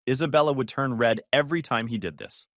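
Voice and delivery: ordinary delivery, nothing marked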